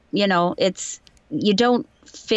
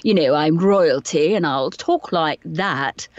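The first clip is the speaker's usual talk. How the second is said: lower, slow royal posh English accent; upper-crust royal accent; 3 semitones lower, slower than usual. Shown as a demonstration. Showing how royalty would talk, upper-crust English accent. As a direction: put on a drawn-out upper-crust British accent. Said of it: "I'm not the upper crust".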